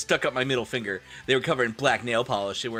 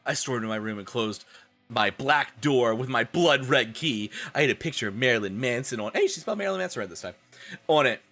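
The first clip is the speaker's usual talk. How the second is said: ordinary talk, nothing marked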